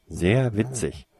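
slow, drawn-out sarcasm; sarcastic grumble. A narrator's reading. Girl answering his teasing, sarcastic grumble. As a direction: stretch it out, unamused. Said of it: "meckerte sie".

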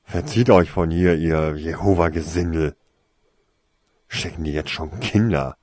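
gruffly voiced dismissal, forceful at the start; snarling. A narrator's reading. Man chasing off supposed Jehovah's Witnesses, snarling. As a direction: send the callers packing. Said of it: "fauchte der Mann".

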